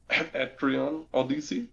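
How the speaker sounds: Etrian Odyssey slowly, as ET-ree-ON oh-dee-see; playfully Frenchify Etrian Odyssey into a slow question; mock French pronunciation. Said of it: "it's gonna be French".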